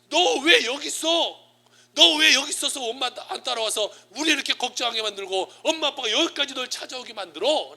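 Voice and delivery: high, loud acted parental scolding; imitated parent's loud outburst; indignant scolding. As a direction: scold a child who made you search everywhere. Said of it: "불만을 팍 표출하는 거예요".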